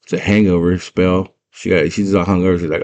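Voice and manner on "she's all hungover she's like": ordinary delivery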